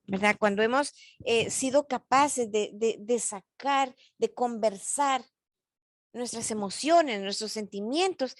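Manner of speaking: normal talking voice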